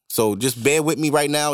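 ordinary delivery

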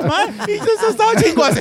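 high, laughing through the quote; laughing mock quote. Retelling being drunk and naked, quoting himself through laughter. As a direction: crack up while quoting yourself.

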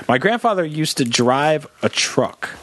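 normal talking voice

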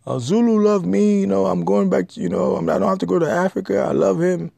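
mock a smitten woman gushing over her man; gushing naive woman. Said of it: "and say".

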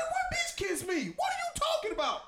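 mock outraged yell; incredulous outburst. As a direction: act out the shocked denial loudly. Retold argument, acting out outrage.

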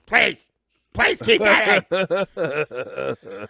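drawn-out imitating plea through laughter; mock pleading. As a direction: parody a friend begging, cracking up. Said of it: "by saying".

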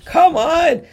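high, loud mock exasperation; exasperated come on. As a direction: throw it out, fed up with the fans. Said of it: "I'm like".

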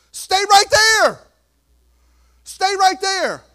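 high, loud and slow, "right" stressed; rising climax of the sermon; loud slow command. Real, not acted. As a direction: plant them in place with force.

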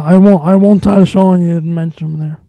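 ordinary talk, nothing marked

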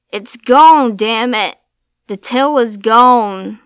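put-on old man yelling at the creature outside; shouting. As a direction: bellow it in panic.

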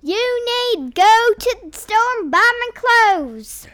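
high, very loud hillbilly holler. Shown as a demonstration. Second try after being corrected, loud hillbilly accent. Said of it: "do your best redneck hillbilly accent".

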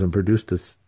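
plain reading voice

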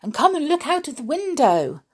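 high eager call; excited urging. Acted. Mister Silly urging his friend to look, excited. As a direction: hurry him to the window. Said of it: "cried Mister Silly".

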